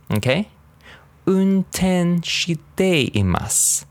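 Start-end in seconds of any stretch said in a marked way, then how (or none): 1.3-3.8 Unten shiteimasu very slow, pitched high; slow model for repeating. Shown as a demonstration. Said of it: "Say a little faster".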